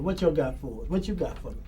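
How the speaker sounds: ordinary talk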